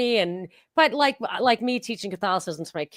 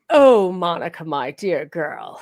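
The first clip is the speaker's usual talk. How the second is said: put-on slightly posh accent; 4 dB louder than usual. Aunt to her niece Monica on the phone, slightly posh accent. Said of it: "it was a little posh".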